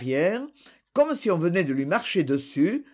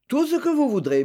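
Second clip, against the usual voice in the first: eager; 8 semitones higher, 3 dB louder than usual. Acted. Eager helper offers service.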